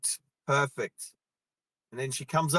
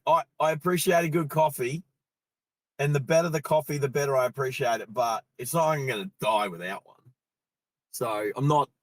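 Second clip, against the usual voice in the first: usual speaking voice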